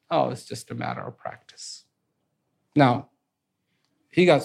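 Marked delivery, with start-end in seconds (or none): 0.1-1.8 softer, lower, dismissive murmur; offhand voice from the crowd. Acted. Bystander belittling the archer, low and quiet. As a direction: toss it off quietly from the back. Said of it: "all he hears at the background".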